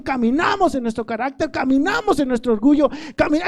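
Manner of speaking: loud, high, impassioned sermon; full-force preaching. Acted. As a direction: drive each phrase home to the congregation.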